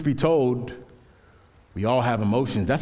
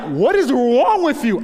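incredulous outburst; 10 semitones higher, 7 dB louder, slower than usual. Retold or imitated. Reacting to date crying at movie.

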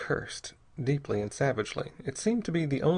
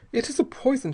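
unsteady; 12 semitones higher than usual. A narrator's reading. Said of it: "she said unsteadily".

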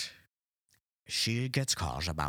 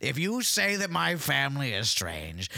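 offended; 7 semitones higher, 4 dB louder than usual. Pretend. Mary offended about family.

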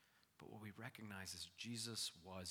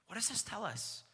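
ordinary talk

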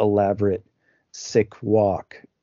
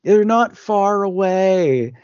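mock phone shouting; 8 semitones higher, 4 dB louder than usual. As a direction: call down the phone as if to someone hard of hearing. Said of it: "she really shouts on the phone".

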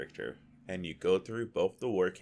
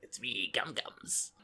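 slow, put-on character voice; character intro; 3 semitones higher, 5 dB quieter, slower than usual. The shaman greeting her friends, slow put-on voice. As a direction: drawl it as the shaman herself.

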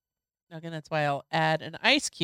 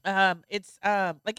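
normal talking voice

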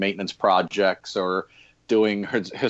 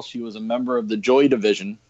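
normal talking voice

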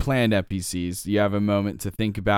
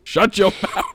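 a touch of a gruff scolding, louder; mock scolding; 7 semitones higher, 3 dB louder than usual. Stable man telling goblin girl to hush, mock scolding. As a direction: tell the goblin off, half laughing.

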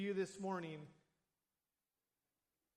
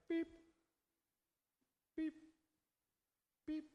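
heart monitor beep; 8 semitones higher than usual. Retold or imitated. Imitating a failing heart monitor.

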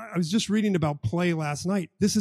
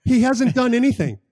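excited emphasis; 9 semitones higher, 8 dB louder than usual. Real, not acted. Marvelling that Jesus did nothing yet.

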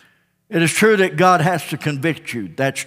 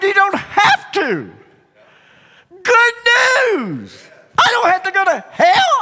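much higher, loud eager shout; excited; 5 dB louder than usual. Retold or imitated. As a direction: burst out with the good news. Preacher's eager reply.

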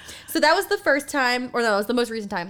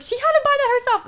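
high, rushed mock-mom protest; imitating a mother's indignant defense; defensive mom; 13 semitones higher, 3 dB louder, much faster than usual. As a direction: deny it fast in a high voice. Mom defending herself, voiced.

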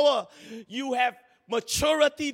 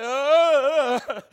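mock owner's yell; 3 semitones higher, 10 dB louder than usual. Pastor mimics a dog owner yanked along, mock yell. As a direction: yell it like you're dragged off by a dog. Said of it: "he go like".

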